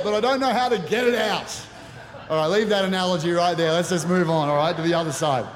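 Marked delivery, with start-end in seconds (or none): 0.0-1.6 laughing self-mockery. Real, not acted. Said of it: "emotionally constipated".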